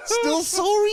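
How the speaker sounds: high laughing squeak. Real, not acted. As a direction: squeeze the words out mid-laugh. Laughing hard mid-story.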